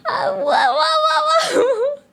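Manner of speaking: high whoas breaking into laughter; can't get the whoas out for laughing. Real, not acted. Retelling Fufu's shock, laughing through high whoas.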